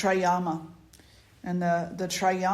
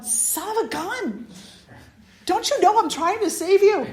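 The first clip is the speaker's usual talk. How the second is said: high-pitched playful scolding; mock exasperation; 10 semitones higher, 4 dB louder than usual. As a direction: scold a runaway bug, amused. Retold scolding of the cricket.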